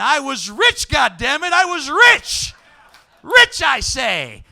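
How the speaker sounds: hammed-up gloating yell, pitch climbing; mock triumphant shout. Comic boasting to the audience about sixty dollars. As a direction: crow about being rich like a miser.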